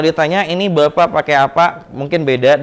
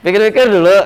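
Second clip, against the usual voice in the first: laughing mock reply; 5 semitones higher, 5 dB louder, faster than usual.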